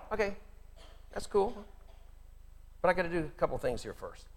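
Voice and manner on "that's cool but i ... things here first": ordinary talk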